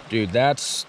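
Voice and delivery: ordinary talk, nothing marked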